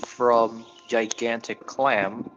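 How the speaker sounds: slow dictation. Acted. Player dictates sending spell message.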